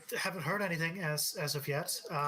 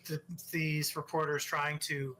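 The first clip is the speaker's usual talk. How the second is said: usual speaking voice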